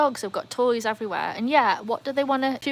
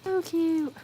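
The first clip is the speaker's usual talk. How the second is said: cooing; 8 semitones higher than usual. Real, not acted. Cooing over baby bat.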